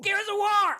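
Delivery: high shouted answer; excited guess. Real, not acted. Guessing the game right, high excited shout. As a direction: blurt the title out triumphantly.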